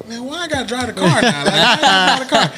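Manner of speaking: high, whiny complaint about driving; hammed-up exasperated protest; indignant whine. Larry David whining to his manager about the car.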